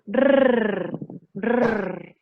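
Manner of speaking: trilled tip-of-the-tongue rrr; rolled Spanish R. Shown as a demonstration. Teacher demonstrating the Spanish rolled R. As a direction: roll the R hard with the tongue tip. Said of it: "vibrando la punta de la lengua".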